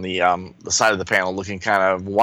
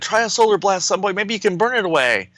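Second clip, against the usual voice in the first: hero voice; 9 semitones higher than usual. Acted. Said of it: "And Superboy's like".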